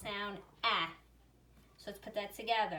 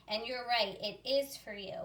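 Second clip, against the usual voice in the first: ordinary delivery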